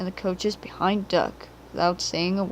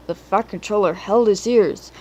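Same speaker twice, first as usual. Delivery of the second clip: usual speaking voice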